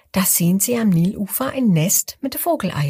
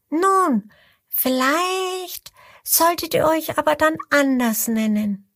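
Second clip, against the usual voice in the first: high, light little crocodile voice; a small bright creature suggesting a new name; voicing the little crocodile, higher; 8 semitones higher than usual. A narrator's reading.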